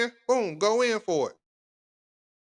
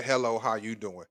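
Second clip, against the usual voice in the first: soft, lower smooth hello; smooth greeting demo; 6 semitones lower, 6 dB quieter than usual. Shown as a demonstration. Host demonstrates the greeting to guys, soft and smooth. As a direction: show him how easy the greeting is. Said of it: "all you gotta do is say".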